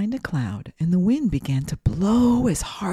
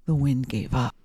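ordinary delivery

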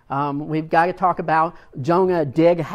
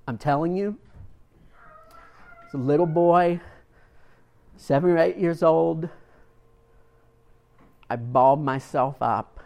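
ordinary delivery

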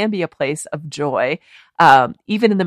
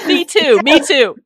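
laughing; 10 semitones higher, 7 dB louder than usual. Real, not acted. Laughing shared meatloaf trauma.

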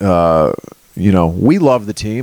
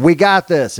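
higher, upbeat quote; hopeful fan text; 7 semitones higher than usual. Retold or imitated. Browns fan texting dad before the game, higher and hopeful. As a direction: read the text full of hope. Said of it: "texting my dad before the game".

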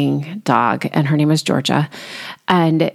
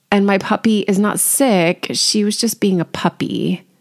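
normal talking voice

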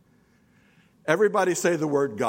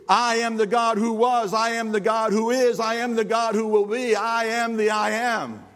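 proclaiming; 9 semitones higher than usual. Acted. Proclaiming God's name.

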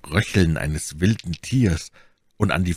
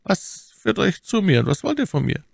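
lightly acted lady in a much higher register; dull-voiced Milady. A narrator's reading. Milady asking the intruder what he wants, dull high voice. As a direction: demand answers in a high, dulled character voice. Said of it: "mit dumpfer Stimme".

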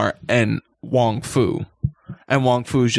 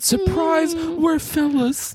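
higher sing-song reveal, stretched out; campy surprise announcement, much higher; mock falsetto reveal; much slower than usual. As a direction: unveil the twist in a put-on high voice.

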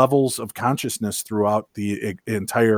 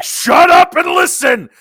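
Gilbert Gottfried impression; 7 dB louder than usual. The universe's guide loses patience with the listener, in a high comic shout. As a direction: yell it like an irritated cartoonish comedian. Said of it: "he starts to turn into Gilbert Godfrey".

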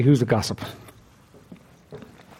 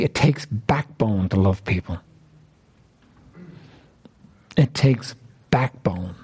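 slow and emphatic; 7 semitones lower, 4 dB quieter, slower than usual. Real, not acted. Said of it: "slow emphatic repeat".

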